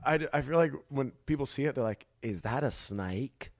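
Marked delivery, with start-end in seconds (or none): 2.2-3.5 put-on slow, drawled Southern-Australian accent; lightly put-on Southern-Australian accent, slow. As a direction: imitate people in a vague Southern-Australian accent. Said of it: "Why are they Australian?".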